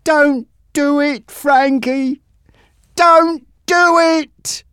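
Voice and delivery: pleading. Acted. Bob begging for his life.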